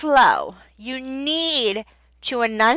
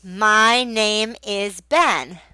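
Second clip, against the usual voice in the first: slow, even modelled sentence; much slower than usual. Shown as a demonstration. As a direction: lead the child word by word. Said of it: "point to your face".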